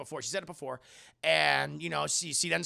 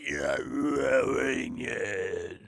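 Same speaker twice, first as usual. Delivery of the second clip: low, drawn-out monster voice; slow right down into a demonic drone; possessed voice; 5 semitones lower, 4 dB louder, much slower than usual. Retold or imitated. Mother becoming possessed at séance, slow demonic voice.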